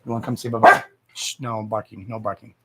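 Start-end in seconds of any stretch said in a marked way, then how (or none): 1.1-2.5 soft hush, no barking; hushed shush. Real, not acted. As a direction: quietly hush the dog. Hushing the dog.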